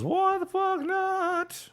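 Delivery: very high, slow silly voice. Pretend. Streamer telling chat the next quest, slow goofy high voice. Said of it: "I don't know why I said that that way".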